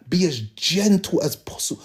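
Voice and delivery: usual speaking voice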